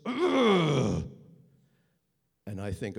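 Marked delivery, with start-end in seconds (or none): 0.1-1.1 menacing grunt. Retold or imitated. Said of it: "and he goes".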